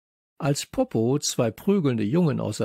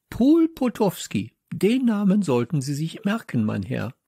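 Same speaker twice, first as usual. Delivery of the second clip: indignant correction; 6 semitones higher, 3 dB louder than usual. Acted. Teacher correcting her garbled name to Josef, indignant. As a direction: correct them in affronted tones.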